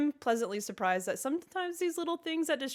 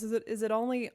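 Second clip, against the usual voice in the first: usual speaking voice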